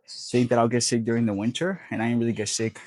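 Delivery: usual speaking voice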